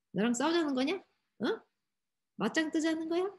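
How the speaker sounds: mildly annoyed challenge, voice lifting at the end; a bit angry. Acted. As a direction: pick a fight half-seriously. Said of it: "지금 모세도 좀 화가 났어요".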